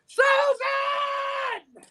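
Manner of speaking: high piercing shout up the stairs; calling out. Retold or imitated. Girlfriend shouting for his mom from the stairway. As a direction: yell the name across the house.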